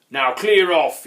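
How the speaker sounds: loud, angry troll snarl. A narrator's reading. Grunt orders the visitor away, snarling. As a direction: snarl it at full volume. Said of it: "he snarled".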